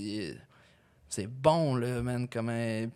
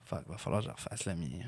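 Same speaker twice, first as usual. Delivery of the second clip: low, soft dismayed mutter; 9 semitones lower, 10 dB quieter than usual. Retold or imitated. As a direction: mutter it under your breath. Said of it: "je fais comme". (juron marmonné, découragé)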